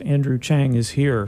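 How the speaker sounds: ordinary talk, nothing marked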